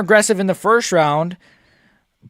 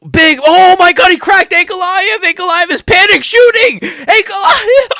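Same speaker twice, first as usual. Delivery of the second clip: much higher, much louder, screaming in disbelief; ecstatic screaming; 12 semitones higher, 6 dB louder than usual. Real, not acted. Fan watching the fight live, screaming as Alex cracks Ankalaev. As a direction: yell it as your fighter drops the rival.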